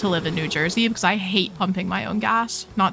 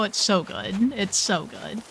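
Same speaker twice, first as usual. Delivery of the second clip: ordinary talk, nothing marked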